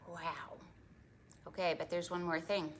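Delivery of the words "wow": whisper. Acted. Awed whisper to kids.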